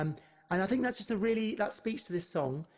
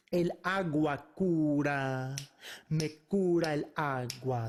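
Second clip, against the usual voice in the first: spoken verse demo; 7 semitones lower than usual. Shown as a demonstration. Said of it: "walk you through the other verses".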